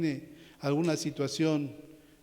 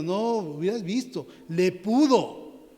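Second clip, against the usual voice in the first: animated retelling; 3 semitones higher, 6 dB louder, slower than usual. Retold or imitated. Preacher voicing everyday speech.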